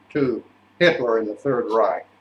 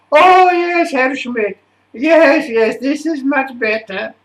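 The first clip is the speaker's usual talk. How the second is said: high-pitched gushing director, loud on each exclamation; delighted stage director; 12 semitones higher, 6 dB louder than usual. Retold or imitated. Stage director approves of the whispered aria, mock delight. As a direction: coo high with exaggerated approval. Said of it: "the auditioner, who was the new stage director".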